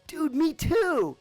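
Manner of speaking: high, slow, delighted; excited. Retold or imitated. Soldier learns the new guy is from Arizona too, delighted. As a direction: stretch it out, thrilled to find a fellow Arizonan. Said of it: "and he goes".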